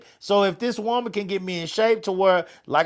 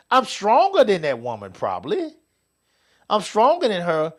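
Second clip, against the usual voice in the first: usual speaking voice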